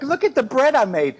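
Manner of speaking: high, chirpy proud parent; mock proud hipster parent. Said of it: "hipster dads and cute young moms like".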